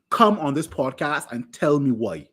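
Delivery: ordinary delivery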